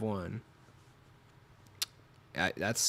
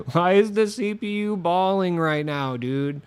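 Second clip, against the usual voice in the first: exasperated outburst; 7 semitones higher, 7 dB louder, slower than usual. Real, not acted. CPU keeps scoring on him.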